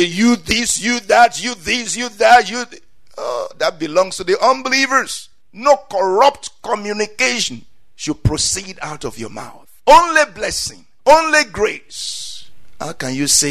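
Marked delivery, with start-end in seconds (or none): 0.0-2.8 loud mock insults hurled; mock cursing. Imitates cursing someone. 9.9-12.5 high, loud, very slow proclamation; proclaiming. Real, not acted. Preacher proclaims emphatically.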